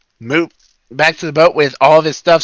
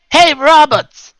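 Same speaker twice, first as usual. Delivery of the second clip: calling out; 12 semitones higher, 5 dB louder, much slower than usual. Acted. Calling out across ships.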